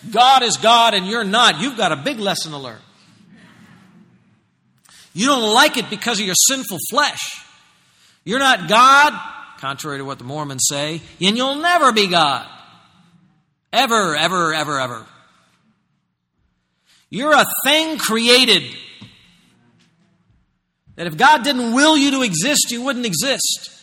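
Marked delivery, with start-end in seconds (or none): 0.1-9.1 loud, high-pitched pulpit rebuke; full-force preaching. Real, not acted. Preacher confronting listeners in their seats, full-force preaching. 11.2-23.7 loud, high, emphatic pulpit declaration; full-force preaching. Real, not acted. Preacher telling listeners they are created things, full-force preaching.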